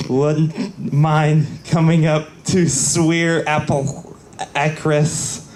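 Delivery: ordinary delivery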